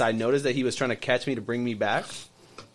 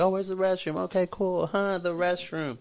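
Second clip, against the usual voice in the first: breezy, higher, casual; playing it cool; 8 semitones higher than usual. Guest faking calm to find the exit, breezy and casual. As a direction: act nonchalant so they suspect nothing. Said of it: "I had to be smooth about it".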